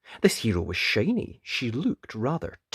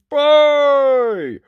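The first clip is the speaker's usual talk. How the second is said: long, high, booming holler; loud giant's call; 12 dB louder than usual. A narrator's reading. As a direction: bellow it out and hold the vowel. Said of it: "a loud".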